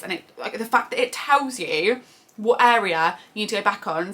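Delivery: ordinary talk, nothing marked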